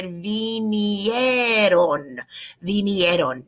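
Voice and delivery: vinieron stretched very slowly; slow spelling-out. Shown as a demonstration. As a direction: dwell on each syllable of vinieron. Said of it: "no accent".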